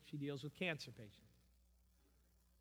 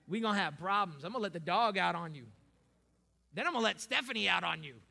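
mock threat; 3 semitones higher, 10 dB louder, faster than usual. Joking threat to congregation.

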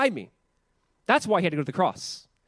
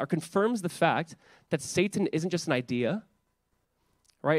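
ordinary talk

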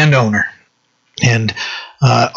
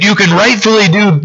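ordinary delivery

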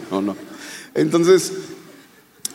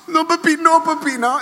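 much higher, pleading little girl voice, a mock sob; crying daughter; 3 dB louder than usual. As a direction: imitate a twelve-year-old begging her dad, high and whiny. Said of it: "mi hija de doce llorando así".

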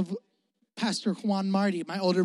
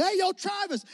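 loud and proud; 9 semitones higher than usual. Retold or imitated. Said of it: "he was loud and proud".

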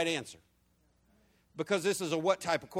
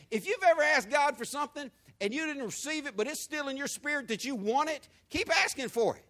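fervent preaching; 8 semitones higher than usual. Acted. Preacher exhorts congregation.

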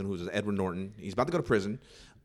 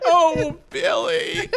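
very high, loud, laughing mock swoon; high laughing falsetto swoon; swooning girlfriend; 10 dB louder, much slower than usual. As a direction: squeal it like a lovestruck girlfriend. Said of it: "she's just so like".

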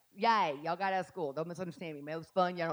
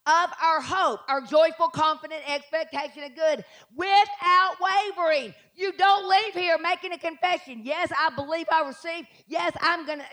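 fiery preaching; 5 semitones higher, 3 dB louder than usual. Real, not acted. Preacher rousing the congregation, high and loud. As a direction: preach it with fire.

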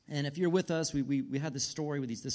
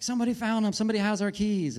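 louder, higher, relieved; excited news; 7 semitones higher, 6 dB louder than usual. Retold or imitated. Wife calling from the trail with relieved, excited news. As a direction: call it out with happy relief. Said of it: "she's like".

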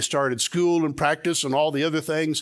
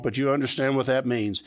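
ordinary talk

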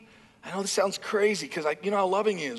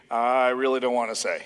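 sheepish dodge; 8 semitones lower than usual. Retold or imitated. Evasive servant.

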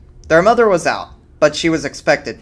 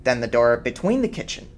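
plain reading voice

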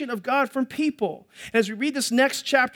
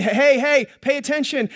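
louder, higher call to the people; prophets calling out; 4 semitones higher, 6 dB louder than usual. Acted. Prophet shouting at the people to pay attention.

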